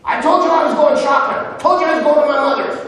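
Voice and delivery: high, fast, indignant rant; exasperated wife. Pretend. Preacher playing a wife scolding her distracted husband, shrill indignant rant. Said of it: "And you're like".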